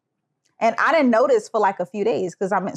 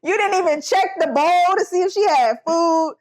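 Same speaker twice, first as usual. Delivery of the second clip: higher, laughing mock-scold from mom; 6 semitones higher than usual. Mother mocking her child over the missing cat, laughing scold. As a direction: tease her through laughter for not noticing. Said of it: "my mom was like".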